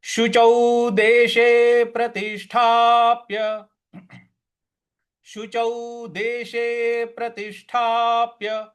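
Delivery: slow verse with stresses on देशे and प्रतिष्ठाप्य; recited with accents. Shown as a demonstration. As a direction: chant it, pressing the stressed syllables. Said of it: "तो इसको किस प्रकार से पढ़ेंगे".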